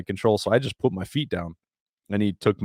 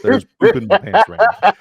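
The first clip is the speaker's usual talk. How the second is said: high, loud, breaking into laughter; laughing; 13 semitones higher, 10 dB louder than usual. Real, not acted. Telling the host about the poop, laughing loud and high. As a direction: laugh through the confession.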